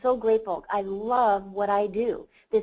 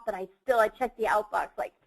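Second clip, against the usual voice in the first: usual speaking voice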